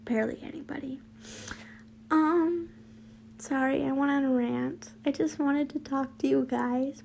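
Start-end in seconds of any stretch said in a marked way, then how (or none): 0.1-1.0 sheepish whisper; whispered aside. Real, not acted. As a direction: mutter it to yourself, embarrassed. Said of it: "self-deprecating aside".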